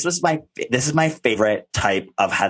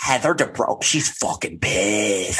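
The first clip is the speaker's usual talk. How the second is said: slow, drawn-out mock fan gossip; exaggerated scandalised fangirl impression; starstruck fans; 9 semitones higher, slower than usual. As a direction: imitate awed fans whispering-in-the-corner gossip, pitch climbing. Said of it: "[name] being fans".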